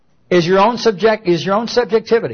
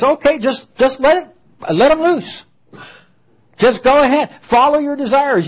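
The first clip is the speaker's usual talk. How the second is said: higher voice waving all desires through; mock permissive voice; 8 semitones higher than usual. Preacher mocks a permissive society, high and coaxing. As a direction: coax like an easy, shrugging tempter.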